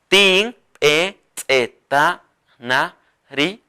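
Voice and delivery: Tin eteta na ri drawn out; slow dictation. Shown as a demonstration. Teacher models the phrase for learners. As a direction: say it slowly. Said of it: "Así".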